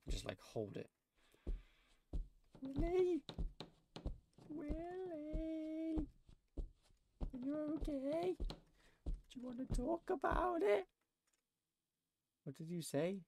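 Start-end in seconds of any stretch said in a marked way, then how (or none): 2.6-10.9 high, soft, exaggerated gentle calling; mock concerned voice. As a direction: coax him sweetly like talking to a sulking child.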